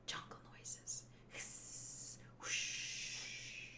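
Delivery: barely-there whisper; hushed, voiceless jungle sounds; whispered sound effects. Acted.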